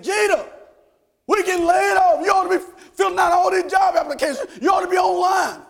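high, loud panicked yelling; upset shouting coworker. Retold or imitated. Coworker warning Jeter about the layoffs, loud panicked shout. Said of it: "he was all upset".

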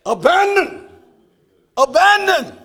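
high, loud "Abandon"; loud preached "Abandon"; emphatic shout. Acted. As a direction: punch the word Abandon. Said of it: "preaching emphasis".

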